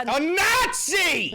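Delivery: very high, loud, drawn-out yell; furious shout. Real, not acted. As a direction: holler it slowly over the other speaker. Bursts in to finish clip's sentence.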